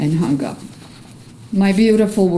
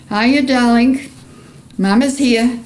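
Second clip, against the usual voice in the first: higher and louder Bronx greeting; loud Bronx accent; 7 semitones higher, 4 dB louder than usual. Retold or imitated. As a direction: call out to her son in a loud Bronx accent. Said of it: "with my loud Bronx accent".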